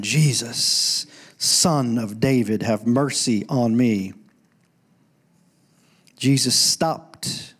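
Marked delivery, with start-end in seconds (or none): 0.0-4.1 low, slow retelling of a yell, not shouted; retold yelling. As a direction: recite the repeated cry slowly, in a lowered voice. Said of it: "He just kept yelling".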